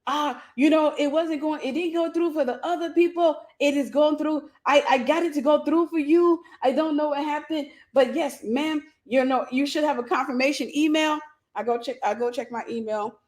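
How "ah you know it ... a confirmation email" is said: higher voice imitating an excited agent; retold gushing, proud excitement. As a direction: mimic him over the moon with excitement. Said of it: "This man gets so uberly excited".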